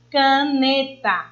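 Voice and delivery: slow caneta, NE held and emphasized; ca-NEE-ta, measured and slow; stress on the middle syllable. Shown as a demonstration. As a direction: draw out the NE in caneta. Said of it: "elevo mais a minha voz na sílaba né".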